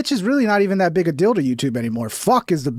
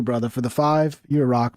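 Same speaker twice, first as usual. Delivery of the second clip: ordinary talk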